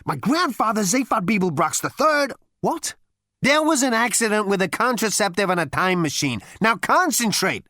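high, insistent character voice; agitated Zaphod. Acted. Zaphod explains his family line to the others, agitated and high. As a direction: pitch it up and press the explanation.

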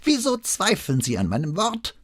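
put-on high, indignant old man's challenge; prickly old notary, pitched high; affronted old man. A narrator's reading. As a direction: demand an answer in a testy old voice.

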